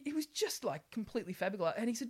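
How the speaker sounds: ordinary talk, nothing marked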